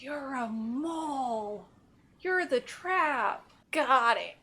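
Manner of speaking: ordinary talk, nothing marked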